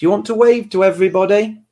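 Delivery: high, singsong parent voice. Real, not acted. Coaxing small child to wave.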